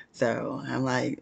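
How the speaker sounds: normal talking voice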